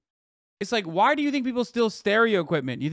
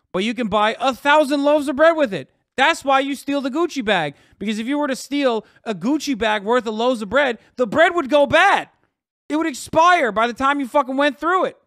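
louder and higher, rising indignation; heated rant; 5 semitones higher, 5 dB louder than usual. Real, not acted. Streamer rants to chat about bread and Gucci bags, angry. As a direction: get fired up and loud.

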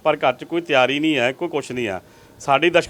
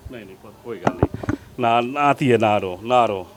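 soothing; 5 semitones lower than usual. Real, not acted. Consoling the crying daughter.

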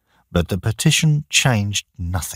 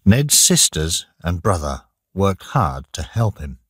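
plain reading voice

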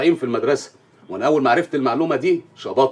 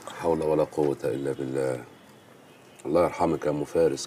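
solemn low lament; 9 semitones lower, 7 dB quieter than usual. Acted. Hearing of neighbour's fall.

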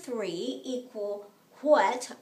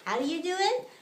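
usual speaking voice

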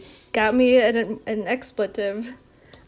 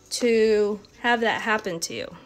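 ordinary talk

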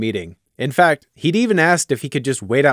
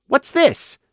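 suspicious; 13 semitones higher than usual. A narrator's reading. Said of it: "he asked suspiciously".

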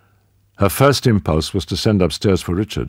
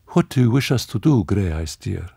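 put-on young woman voice; 4 semitones higher than usual. A narrator's reading. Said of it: "Marie-Lou asked the Duke".

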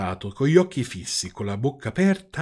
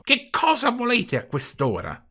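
put-on much higher-pitched cross old man; irritated shout; 12 semitones higher than usual. A narrator's reading. Old man at his window to night-time caller, irritated shout. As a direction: cry out in peeved, put-on indignation. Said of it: "il quale gridò tutto stizzito".

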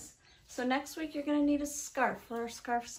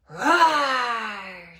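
loud, drawn-out storytime roar; big sustained roar on the word; lion roar; 11 dB louder than usual. Acted. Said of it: "says the lion".

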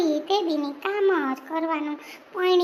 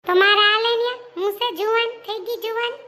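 loud, higher-pitched comeback; angry retort; 5 semitones higher, 5 dB louder than usual. Acted. Daughter-in-law answering back in a quarrel, angry retort. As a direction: fire back loudly, then ease off in volume.

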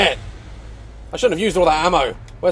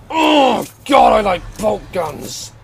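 loud, relishing groan into words; excited, slow exclamation; gleeful outburst; 5 semitones higher, 3 dB louder, slower than usual. Real, not acted. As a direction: savour it loudly, drawn out. Relishing a kill in the game.

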